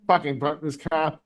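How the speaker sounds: normal talking voice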